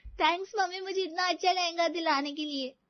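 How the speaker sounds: put-on high, sweet young girl voice; girlish thanks. Daughter thanking mother for lehenga, high girlish voice. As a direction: gush thanks like a little daughter.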